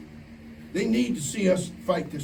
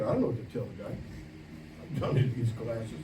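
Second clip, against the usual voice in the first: whispered inner thought; 7 semitones lower, 9 dB quieter than usual. Retold or imitated. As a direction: breathe the thought out as if no one may hear. Retold inner thought at lunch.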